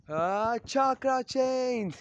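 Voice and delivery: high, long-drawn attack yell; battle cry. Acted. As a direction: stretch the technique's name into a war cry. Menma shouts his attack.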